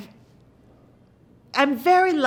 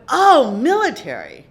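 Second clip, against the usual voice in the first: a sudden loud "oh" as it clicks; loud realization; 4 semitones higher, 11 dB louder than usual. Retold or imitated. To the showrunner, getting the note, loud realization. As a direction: snap into military mode, loudly. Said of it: "So I went".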